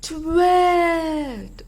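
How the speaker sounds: loud high shouted twit; all the animals shouting together. Acted. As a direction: shout it with everything you've got. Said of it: "shouted at the top of their voices".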